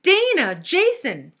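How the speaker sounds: loud calling out of names; shouting. A narrator's reading. Allison calling classmates who ignore her, loud shout. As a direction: keep yelling for anyone to answer. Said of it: "shouted Allison".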